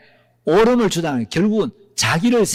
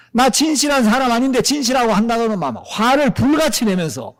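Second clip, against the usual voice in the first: ordinary delivery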